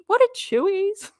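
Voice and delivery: high, baffled viewer voice; a lightly voiced puzzled viewer; confused chat voice. Quoting chat confused by Aussie slang, in a high baffled voice. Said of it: "everyone would be left in the chat going".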